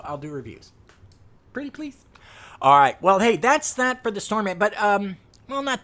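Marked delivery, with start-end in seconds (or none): 1.5-2.0 pretty please. Real, not acted. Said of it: "begging DC". 2.6-5.8 loud. Real, not acted.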